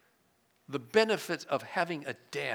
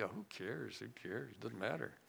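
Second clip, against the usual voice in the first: mock complacency; 5 semitones lower, 15 dB quieter than usual. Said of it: "you hear the complacency?".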